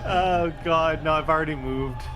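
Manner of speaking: ordinary talk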